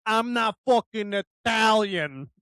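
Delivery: mock Italian-American accent, indignant denial; imitated East Coast Italian accent. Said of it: "like this when he said".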